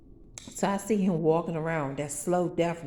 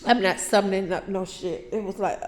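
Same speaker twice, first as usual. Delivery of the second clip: normal talking voice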